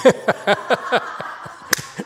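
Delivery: high, hearty burst of laughter; loud breathy laughing fit. Real, not acted. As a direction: crack up at the remark. Laughs at the student's remark.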